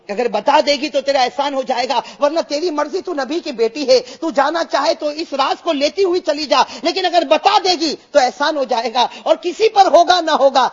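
high, loud impassioned plea; fervent preaching. Acted. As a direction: cry it out to the crowd. Preaching Ali's plea at full force. (پورے جوش سے بلند آواز میں وعظ)

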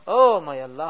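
ordinary talk

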